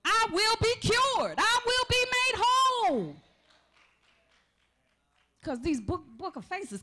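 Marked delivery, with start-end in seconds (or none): 0.0-3.2 high, loud declaration of healing; preaching shout. Real, not acted. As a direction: proclaim it to the back row.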